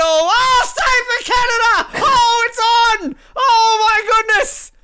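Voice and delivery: high, loud shouting over a save; excited. Real, not acted. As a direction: yell it like you can't believe the keeper stopped it. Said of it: "I'm getting excited".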